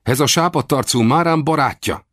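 high-pitched protective exclamation; mildly shouted, high warning; warrior's cry. A narrator's reading. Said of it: "kiáltotta Márám".

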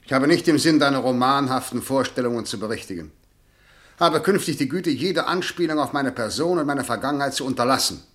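loud, high, clipped reprimand; cold angry rebuke. Acted. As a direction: put her firmly in her place.

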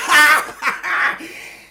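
scornful laugh. Acted. Mocking laugh at the official.

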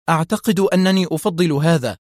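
plain reading voice

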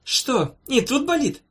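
high-pitched doctor's query; incredulous rising pitch; surprised questioning. A narrator's reading. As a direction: ask with exaggerated surprise. Doctor examining, feigning surprise.

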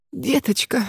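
тише, слегка запыхавшись; чуть задыхаясь, на выдохе. A narrator's reading. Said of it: "слегка задыхаясь". (со слабой одышкой, тише)

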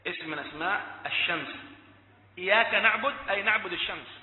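ordinary talk

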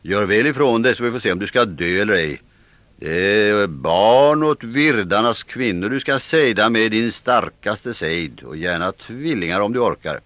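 hög, högljudd och långsam hotelse; kräv det av honom med hot; hotfull befallning. Acted. Virdarnas man hotar magistern. (hotfull, högljudd befallning)

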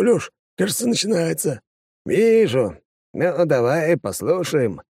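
lightly voiced smug deputy; pleased deputy voice. Фесков хвастает и слушает. (довольный депутат)

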